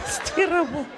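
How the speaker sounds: high-pitched complaint; mock lament. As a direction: lament it high, holding back slightly. Mock lament, first take.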